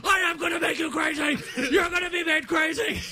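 high, hammed-up mock shouting; screamed threat. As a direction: yell back in imitated fury. Acted screaming quarrel.

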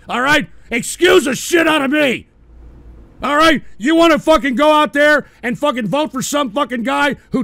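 loud, heated shouting rant; angry rant. Real, not acted. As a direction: blast it out, fed up and furious. Ranting about the Republican Party.

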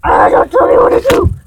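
high, loud bratty yell; angry shout. Pretend. Argument over being told sleep.

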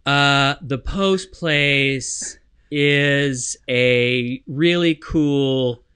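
frozen, halting, drawn-out delivery; deer in the headlights. Retold or imitated. Friend freezing in front of the camera, halting and dazed. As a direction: mimic someone freezing on camera, very slowly. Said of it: "a deer in the headlights".

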